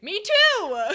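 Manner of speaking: high, loud wailed outburst; frantic agreement. Real, not acted. Streamer agrees with a frightened viewer, high loud wail. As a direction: cry it out in shared fear.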